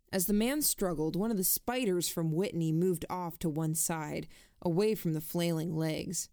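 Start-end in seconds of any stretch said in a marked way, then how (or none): none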